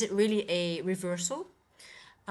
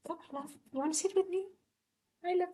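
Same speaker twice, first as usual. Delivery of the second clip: high pet talk; 10 semitones higher, faster than usual. Real, not acted. Owner asking her cat to sit with her, high and doting. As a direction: invite the kitty in a sweet high voice.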